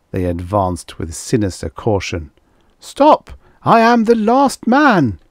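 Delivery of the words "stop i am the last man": very high, loud command to halt; urgent cry. Acted. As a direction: cry out to stop their advance. Cornered man halts advancing women.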